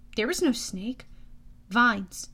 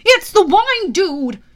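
high, loud, drawn-out shout; panicked horse sidekick yelling; alarmed yell; 8 semitones higher, 13 dB louder, slower than usual. Acted. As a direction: yell a goofy warning. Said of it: "Blackjack yelled".

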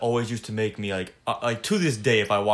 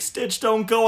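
Lilo pleading; 11 semitones higher, 5 dB louder, slower than usual. Retold or imitated. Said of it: "And she's like".

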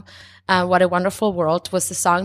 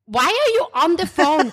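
mom's quote; 9 semitones higher than usual. Retold or imitated. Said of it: "And she's like".

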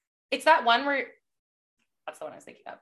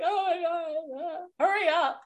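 anxious viewer; 9 semitones higher, slower than usual. Retold or imitated. Voicing an impatient, anguished viewer.

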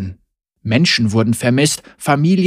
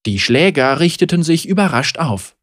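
ordinary delivery, nothing marked

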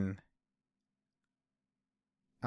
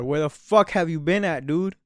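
higher, louder imitation of a furious mom; angry mom; 4 semitones higher, 6 dB louder than usual. Mom on the porch scolding her late son, loud and higher. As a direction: lay into him like his mom did.

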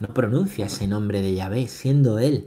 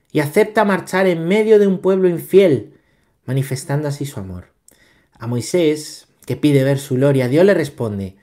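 loud, raised-pitch scripture reading; formal reading aloud; 4 semitones higher, 7 dB louder than usual. Acted. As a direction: proclaim the text, projecting phrase by phrase. Reads the Catechism aloud. (lectura proclamada en voz alta)

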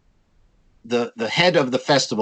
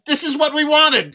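yelled back much higher and louder; triumphant retort, pitched up high; mock yell back; 14 semitones higher, 4 dB louder than usual. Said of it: "I yelled back at them".

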